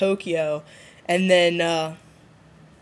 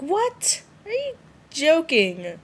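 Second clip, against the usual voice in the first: high incredulous what; shocked disbelief; 9 semitones higher, slower than usual. Retold or imitated. To the viewers about the sea monster, shocked disbelief. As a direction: squeak out the disbelief. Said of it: "I was like".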